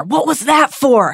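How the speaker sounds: restrained bellow, pitched high; indignant, drawn-out mild yell. A narrator's reading. Harry just punched by Ron, bellowing in mild earnest. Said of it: "Harry bellowed".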